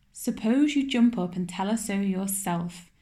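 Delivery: plain reading voice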